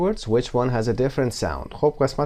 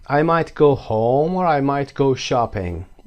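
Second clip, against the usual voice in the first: slow, clear example sentence; slow model answer; 4 semitones higher, 3 dB louder, much slower than usual. Shown as a demonstration. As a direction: read it slowly so students can copy.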